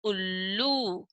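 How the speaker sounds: उल्लू said slowly with a falling end; slow clear reading. Shown as a demonstration. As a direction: draw out उल्लू for the children. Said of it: "सबसे पहले दे रखा है देखो".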